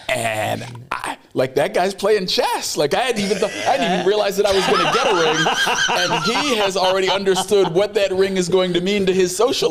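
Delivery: laughing high while he talks; real laughter running under the words; high-pitched laughing disbelief. Real, not acted. Laughing at friend's ring scheme.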